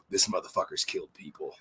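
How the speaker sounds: put-on hushed inner voice realizing the old man has killed; inner thought. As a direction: say it under your breath, stunned. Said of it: "I'll go".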